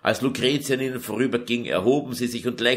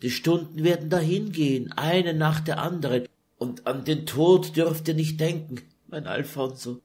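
sobbing; 7 semitones higher than usual. Acted. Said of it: "schluchzte Lucretia".

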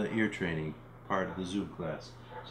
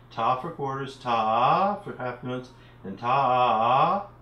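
ta stretched long for half notes; drawn-out ta; 2 semitones higher, 6 dB louder, slower than usual. Shown as a demonstration. Teacher modelling rhythm syllables, ta drawn out long. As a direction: hold the ta out like the note. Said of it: "using the syllables T".

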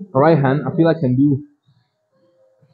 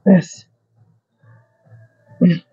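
straining through last reps; 3 semitones higher, slower than usual. Real, not acted. Grinding through last set.

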